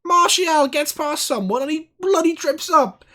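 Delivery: yelling high and loud, slowed down, at the ref; furious shout. Real, not acted. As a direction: bellow your outrage at the referee. Shouting at the referee.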